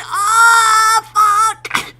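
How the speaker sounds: high-pitched wail, starting very loud; hammed-up overwhelmed whine; emotional meltdown. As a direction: squeal in mock despair at being so touched. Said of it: "I had a meltdown".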